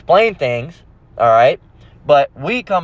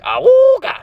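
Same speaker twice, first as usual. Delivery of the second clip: high, loud cartoon horn whoop; Looney Tunes awooga; 5 dB louder, slower than usual. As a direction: hoot it like a leering cartoon wolf. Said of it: "like, Looney Tunes when they'd be like".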